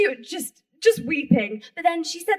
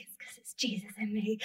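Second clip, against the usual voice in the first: hushed, voiceless confiding whisper; under the breath, fully whispered; whispered aside; 6 semitones lower, 11 dB quieter than usual. Retold or imitated. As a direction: whisper it like a secret. Aside voiced as private thought.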